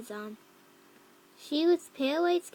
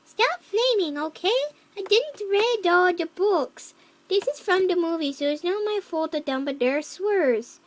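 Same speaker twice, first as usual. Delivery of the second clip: louder, higher, indignant telling-off; defensive outburst; 4 semitones higher, 6 dB louder than usual. Acted. Fan fiction author telling critics to stop flaming, loud defensive rant. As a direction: protest loudly as an offended author.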